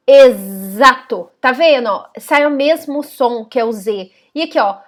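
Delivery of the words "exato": "exato" slowly with the x as a clear z. Shown as a demonstration. Teacher sounding out "exato" slowly. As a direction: stretch "exato" to show the z. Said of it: "Quando nós falamos exato".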